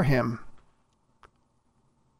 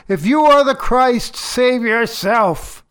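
high, raised sarcastic jeer; mock him sarcastically, drawing out the last words; sarcastic taunt; 5 semitones higher than usual. Crowd mocking Jesus on the cross, sarcastic. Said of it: "sarcastically saying these things".

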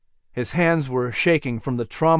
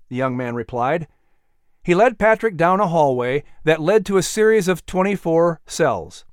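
ordinary delivery, nothing marked

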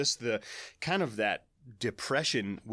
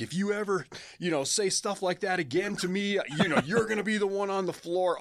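retold yelling; 6 semitones higher than usual. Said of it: "I was yelling and screaming".

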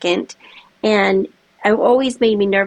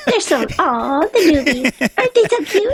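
put-on high, doting baby-talk coo; cooing over newbies; 7 semitones higher than usual. Host cooing over nervous newcomers, high doting voice. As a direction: coo it like fussing over a puppy.

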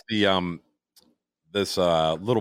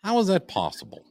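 puzzled; 13 semitones higher than usual. Real, not acted. Puzzled at audio doubling.

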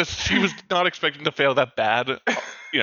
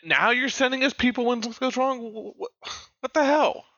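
high-pitched, indignant stammer; acted angry yelling; 9 semitones higher than usual. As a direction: sputter in outrage at the caller. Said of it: "start yelling at him".

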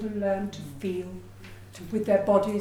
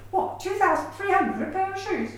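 mock astonishment; 8 semitones higher than usual. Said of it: "I just think".

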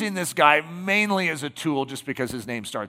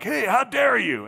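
mock indignant Satan; 7 semitones higher than usual. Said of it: "And it's like".

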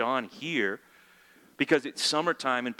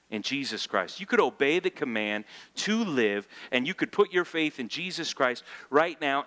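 usual speaking voice

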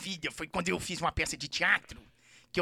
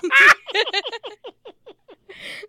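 high squealing laughter; helpless laughter; laughing; 8 dB louder than usual. Real, not acted. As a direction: laugh until it almost cries. Laughing at the fart joke.

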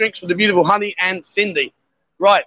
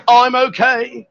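loud, slow and raised in pitch; stretched-out, projected example; loud demonstration; 4 semitones higher, 3 dB louder, much slower than usual. Shown as a demonstration. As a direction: say it slowly and loud for her to copy. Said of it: "speak really loud".

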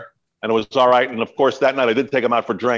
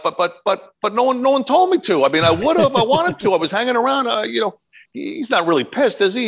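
high, stammering mock panic; acted-out nervous backpedalling; flustered protest; 10 semitones higher than usual. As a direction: plead your excuse in a high voice. Retells his flustered protest to chief.

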